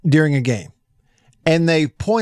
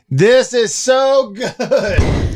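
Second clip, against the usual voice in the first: slow, high, drawn-out rave; gushing over sausage; 9 semitones higher, 4 dB louder, much slower than usual. Retold or imitated. Host raving to co-hosts about the sausage, slow and gushing. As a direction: savor every word like it's heaven. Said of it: "and I'm like".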